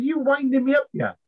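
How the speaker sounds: high-pitched retold disbelief; incredulous challenge. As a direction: challenge him, voice jumping right up. Challenges the specialist's claim.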